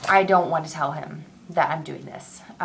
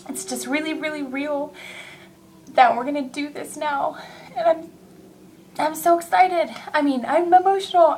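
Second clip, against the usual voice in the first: higher, choked-up happy tears; fighting back joyful tears; tearful and emotional; 7 semitones higher than usual. Real, not acted. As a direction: voice catching with emotion. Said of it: "I'm getting emotional now".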